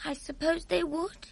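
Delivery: put-on high, small girl voice asking; a young girl's puzzled question; curious little girl. Sophie answers cautiously.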